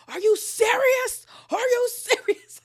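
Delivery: very high, loud mock screech; drawn-out, high-pitched incredulous yell; Meredith. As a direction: imitate her shrieking in outraged disbelief. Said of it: "So now Meredith is activated".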